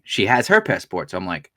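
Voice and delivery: ordinary talk, nothing marked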